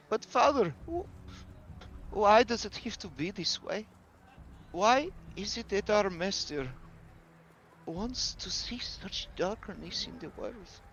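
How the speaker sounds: put-on high, pleading girl's voice, a mild tearful edge; crying girl. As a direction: beg her father to explain, close to tears. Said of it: "as she cries".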